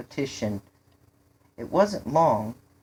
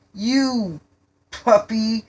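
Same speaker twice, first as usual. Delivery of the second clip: high, loud, broken-up insult; startled retort; 8 semitones higher, 6 dB louder, much slower than usual. A narrator's reading. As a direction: sputter it after a scare. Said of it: "the only words David could muster".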